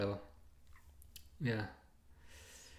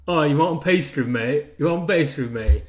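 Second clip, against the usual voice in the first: louder mock English accent, punching the offer; imitated English accent; 6 dB louder than usual. Frenchwoman offering the customer pastry in imitated English accent. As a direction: put on a Frenchwoman's try at English slang. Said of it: "like an English person".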